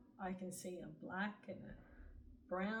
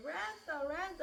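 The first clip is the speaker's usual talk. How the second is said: much higher, tearful calling out of the name; retold sobbing plea to a lost love; crying his name; 9 semitones higher, 5 dB louder than usual. Reliving the reunion with her soulmate, high tearful calling. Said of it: "and I'm crying".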